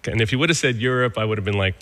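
usual speaking voice

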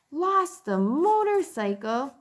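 put-on high, slow shocked boy voice; can't believe what he just heard. Keith shocked by the confession.

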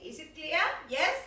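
normal talking voice

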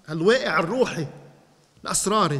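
ordinary talk

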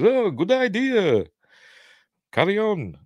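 lightly mocking high officer voice; colonel's voice. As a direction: play the approving colonel, hammed up. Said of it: "his broad American accent".

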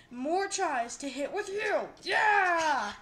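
loud, high victory yell; triumphant gloating. Real, not acted. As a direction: build from loud taunt to big cheer. Triumphant gloating at game target.

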